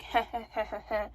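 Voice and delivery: imitated low chuckle; mock laugh. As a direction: mimic a friend's careless heh-heh. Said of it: "he's laughing".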